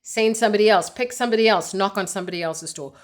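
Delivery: normal talking voice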